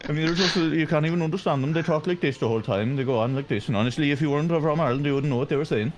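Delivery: quick attempt at a Kerry accent; lightly done fast Kerry accent. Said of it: "That was a pretty solid attempt at a Kerry accent".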